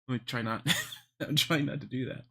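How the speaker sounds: ordinary talk, nothing marked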